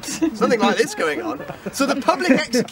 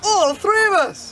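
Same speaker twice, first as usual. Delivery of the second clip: cheerful simpleton sailor, raised pitch; dopey sailor voice; 7 semitones higher than usual. Acted. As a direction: gormless sailor repeating his phrase. Sailor's catchphrase voiced in story.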